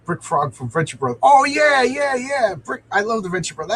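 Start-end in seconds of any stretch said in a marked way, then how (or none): 1.2-3.8 sudden high, loud burst of delight; high excited yeah yeah; excited recognition. Real, not acted. Recognizes character named in chat.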